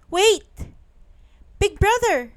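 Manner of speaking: high loud desperate call; cried out. Acted. Boy calls after the leaving bear, high loud cry. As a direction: shout after him, high and pleading. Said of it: "he cried".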